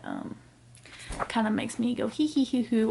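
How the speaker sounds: usual speaking voice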